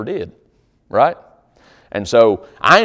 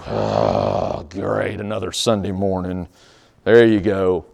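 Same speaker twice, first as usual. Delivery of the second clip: grudging; 4 semitones lower, slower than usual. Retold or imitated. A grudging churchgoer at the offering plate, low sour grumble. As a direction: grumble it out like it pains you. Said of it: "That's grudgingly".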